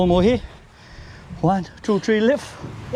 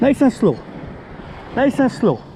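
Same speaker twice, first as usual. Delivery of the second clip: calling out instructions; 6 semitones higher than usual. Real, not acted. Guiding the lift.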